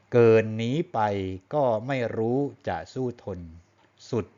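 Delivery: measured verse reading, phrase by phrase; poem recitation. Shown as a demonstration. Teacher demonstrating his own sakkawa, measured poem recitation. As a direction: recite the verse in short, separated rhythmic groups.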